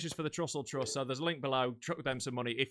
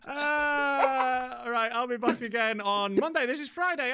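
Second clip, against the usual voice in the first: pitched up high after laughing; really high voice; 7 semitones higher, slower than usual. Real, not acted. Host signing off after a laughing fit, voice really high. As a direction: sign off in a voice stuck high. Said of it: "I've got really high now".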